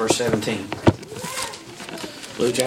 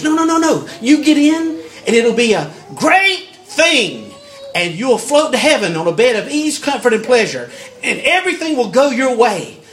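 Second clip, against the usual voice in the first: higher and louder, with a laugh in it; mocking false teacher; 6 semitones higher, 3 dB louder than usual. Preacher mocking false teachers' easy promises, higher and louder. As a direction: lampoon a slick promise, raised and laughing. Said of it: "told by the false teachers".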